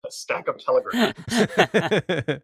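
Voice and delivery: slow smooth authoritative announcer; Mid-Atlantic radio voice; sexy voice. Pretend.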